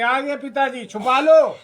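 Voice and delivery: high, loud acted warning; mock alarm. Children warning that father has come, mock alarm.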